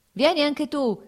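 high, loud beckoning call; peasant's shout. A narrator's reading. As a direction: holler an invitation across the field. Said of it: "gridava il contadino a Lievin".

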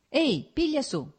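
acted shout, brief and high; calling out. A narrator's reading. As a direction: call out sharply for service, pitch jumping up. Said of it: "gridò al tartaro".

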